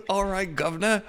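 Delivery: high, loud cockney caricature; mock British accent. Teasing a friend's wrong accent, mock British accent.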